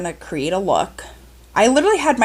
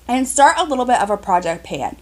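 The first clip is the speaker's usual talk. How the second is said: ordinary talk, nothing marked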